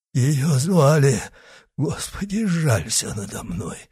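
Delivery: high, halting plea; whispering. A narrator's reading. Dying executioner begs God for mercy, whispering. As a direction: plead in a failing voice. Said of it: "шептал палач".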